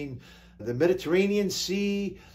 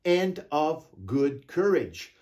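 slow solemn reading; 4 semitones lower, slower than usual. Acted. Solemn scripture reading, drawn out.

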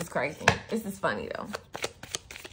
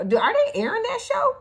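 much higher, louder disbelief; surprised; 5 dB louder than usual. Real, not acted. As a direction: pop up in amused disbelief. Sudden surprise at Power Rangers.

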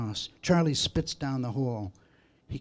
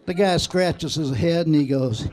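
normal talking voice

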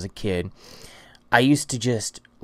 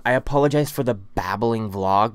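ordinary delivery

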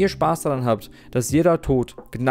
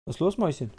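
talking to a pet; 5 semitones higher, 5 dB quieter, faster than usual. Real, not acted. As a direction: coo sweetly to your little dog. Talking to his dog.

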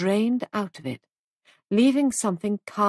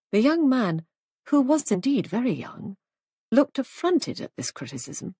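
plain reading voice

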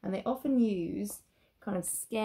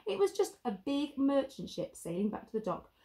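plain reading voice

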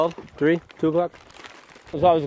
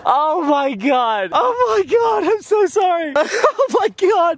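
shocked laughing panic; 12 semitones higher, much slower than usual. Real, not acted. Apologizing to his friend after rolling the car, high and stunned.

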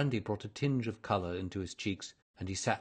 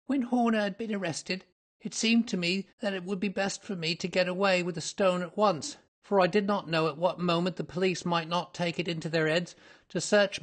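higher, louder anxious suspect's voice; agitated, high-pitched culprit recounting events; frightened culprit confessing; 10 semitones higher, 7 dB louder than usual. Acted. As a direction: tell the tale as a nervous confession.

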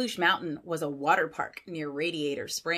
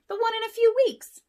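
very high, loud cartoon tow truck; Mater's voice; 9 dB louder, faster than usual. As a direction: pipe up cheerfully. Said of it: "said Mater".

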